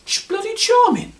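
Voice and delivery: high, sarcastic huff of indignation; mock offended. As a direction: play the wounded husband, squeaking with outrage. Indignant reaction to wife's jibe.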